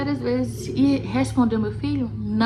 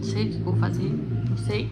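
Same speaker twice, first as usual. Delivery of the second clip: real nervous voice. Real, not acted. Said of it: "eu tô muito nervosa".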